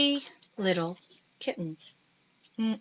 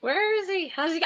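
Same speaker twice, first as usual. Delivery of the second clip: ordinary delivery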